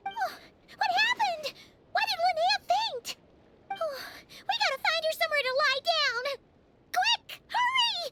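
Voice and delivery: fast high panicked words; panicked rush. Acted. Partner panicking over fainted Linnea, fast alarmed pleas.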